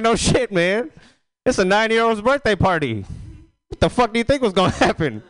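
high incredulous outburst at the uncle; incredulous retort. Retold or imitated. Nephew scolds his crackhead uncle, high and incredulous. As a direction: squeak it out in disbelief.

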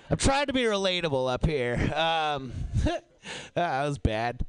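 normal talking voice

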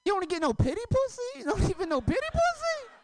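very high squeaky mock voice; hammed-up high audience voice; mocking heckler. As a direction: squeal it like a teasing woman. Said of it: "someone was".

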